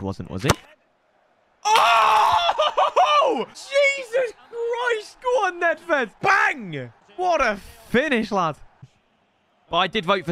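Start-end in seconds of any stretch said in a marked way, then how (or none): 1.6-8.6 very high shouting with laughter; ecstatic goal celebration. Real, not acted. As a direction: scream it out in delight at the goal.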